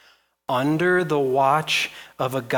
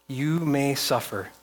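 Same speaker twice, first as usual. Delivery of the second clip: usual speaking voice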